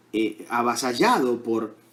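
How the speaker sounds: normal talking voice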